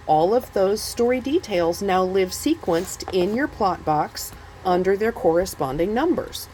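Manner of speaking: ordinary delivery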